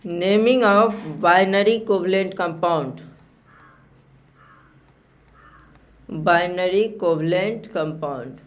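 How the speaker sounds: each word stretched very slowly; slow dictation. Shown as a demonstration. Teacher dictating the heading to students, very slowly. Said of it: "slow dictation of heading".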